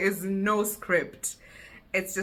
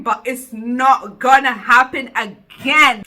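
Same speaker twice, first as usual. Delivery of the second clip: loud, slow vow, "gonna" weighted; said with my chest; 4 semitones higher, 10 dB louder, slower than usual. Real, not acted. Promising viewers, slow and loud. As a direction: declare it firmly. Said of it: "I said it with my chest".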